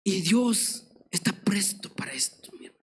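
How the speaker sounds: preacher whispering God's readiness to the congregation; whispered assurance. Acted. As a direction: lean in and whisper it.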